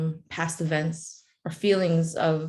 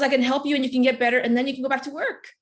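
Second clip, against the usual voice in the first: quick, higher-pitched doctor's good news; excited doctor; 6 semitones higher, faster than usual. Retold or imitated. Doctor promises patient she can get better, excited. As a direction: rattle it off as the eager doctor. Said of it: "he goes so excited".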